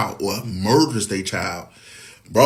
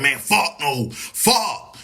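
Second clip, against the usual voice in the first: mildly indignant man, pitch leaping on the last curse; imitating a man who won't have it; mock indignation; 4 semitones higher, much slower than usual. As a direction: put on an offended man's refusal. Mimics indignant men swearing.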